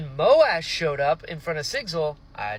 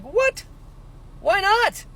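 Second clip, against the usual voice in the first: high, rising disbelief; shocked; 13 semitones higher, slower than usual. Retold or imitated. Reader reacts to the mom staying, high shock. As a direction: toss out the questions in shock. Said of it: "I was like".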